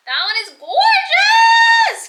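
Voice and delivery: very high, loud and slow; excited shout. Real, not acted. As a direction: gush over it at the top of your voice. Sees the nail color she loves.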